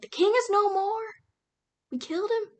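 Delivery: loud, high victory cry; triumphant shout. Retold or imitated. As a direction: announce it to the whole crowd. Crowd proclaims the king's death.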